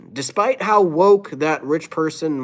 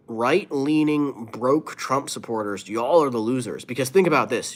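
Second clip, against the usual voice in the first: usual speaking voice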